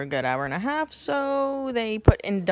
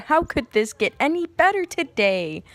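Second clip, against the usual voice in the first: higher, louder mock enthusiasm; sarcastic delight; 6 semitones higher, 5 dB louder than usual. Patient told she needs a catheter, sarcastic delight. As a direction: exclaim it high and loud with fake delight.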